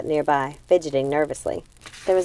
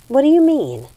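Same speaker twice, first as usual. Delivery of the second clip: confused; 9 semitones higher, 6 dB louder than usual. Acted. Gothel confused asks Hazel.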